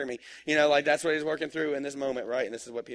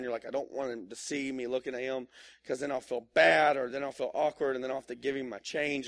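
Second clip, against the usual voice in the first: normal talking voice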